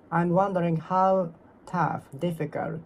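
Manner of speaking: ordinary talk, nothing marked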